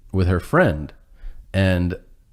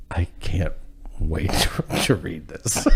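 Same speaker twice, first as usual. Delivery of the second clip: whispered, softer fake enthusiasm; breathy whisper with a stifled laugh; mock whispered eagerness; 5 dB quieter than usual. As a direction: hush it like you're lying through your teeth. Fake eager reply, hushed.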